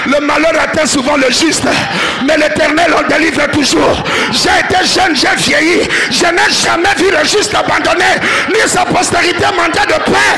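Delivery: high, loud scripture proclamation; full-force preaching. Acted. Preacher proclaiming scripture to the church, loud. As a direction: proclaim the psalm with fire.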